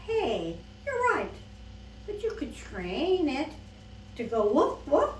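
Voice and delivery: high, loud, bouncy salesman; animated storybook voice; upbeat shelter keeper. Acted. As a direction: sell it brightly with punched woofs. Keeper talks up the lizard.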